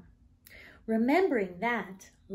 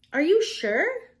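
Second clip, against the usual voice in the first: put-on higher, louder and slower girl voice; child coaxing slowly, higher and louder; coaxing classmate; 9 semitones higher, 8 dB louder than usual. A narrator's reading. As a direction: tempt her, drawing it out. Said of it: "asked Anna".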